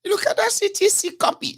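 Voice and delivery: very high falsetto protest; mock lying minister. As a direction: insist in a squeaky parody of a lying minister. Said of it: "how you talk when you are lying".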